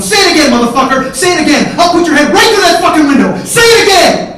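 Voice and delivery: full-force high shout, building; furious, escalating yell; enraged screaming. Retold or imitated. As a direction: scream threats at a pinned brother. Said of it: "And I'm screaming".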